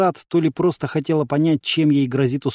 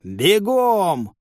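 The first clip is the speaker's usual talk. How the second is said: higher, drawn-out order; command; 5 semitones higher than usual. A narrator's reading. Commander sends the squad running, shouted command. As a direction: call the charge. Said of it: "скомандовал Бурборыч".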